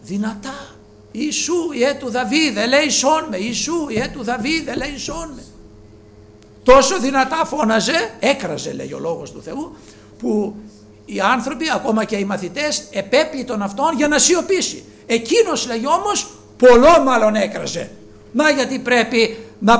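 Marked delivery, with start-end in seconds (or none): 1.1-5.4 high, loud repeated plea; loud crying out. Retold or imitated. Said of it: "έπιασε να φωνάζει δυνατά". 6.6-17.9 high, loud preaching; fervent preaching. Acted. Preaching at full force.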